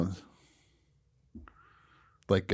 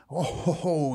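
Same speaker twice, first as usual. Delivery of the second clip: mock jolly laughter; 6 semitones higher, 4 dB quieter than usual. Acting out his big jolly laughter.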